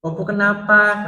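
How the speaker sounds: put-on high, loud upset child's question; retold frightened child. Crying child asking about grandmother, frightened. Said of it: "Anak saya nangis, takut".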